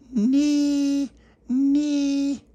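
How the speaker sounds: put-on very high babbling baby call. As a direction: squeak like a tiny baby calling. Baby Lucia shouting through letterbox.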